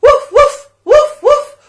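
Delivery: very high, loud woof woof; joyful puppy barks; dog barking. Acted. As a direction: yip like an excited puppy. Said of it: "George finally went".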